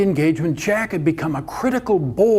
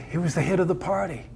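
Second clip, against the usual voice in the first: plain reading voice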